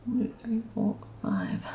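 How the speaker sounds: whispering the count; soft whispered stitch count. Real, not acted. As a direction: breathe the numbers almost silently.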